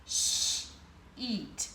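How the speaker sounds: "sh-eat" with a long sh and a stretched ee; sound it out. Shown as a demonstration. Teacher sounding out sheet for the class. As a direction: split "sh-eat" slowly. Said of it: "we have the word".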